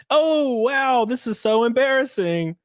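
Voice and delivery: high, hammed-up fake embarrassment; mock embarrassment. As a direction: overplay a creator pretending to be mortified. Mock embarrassed creator after the leak.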